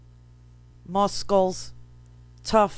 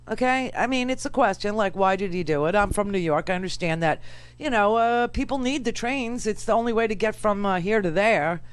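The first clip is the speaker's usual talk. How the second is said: normal talking voice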